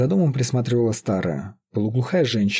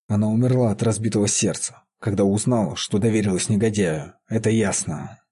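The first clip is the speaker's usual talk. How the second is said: usual speaking voice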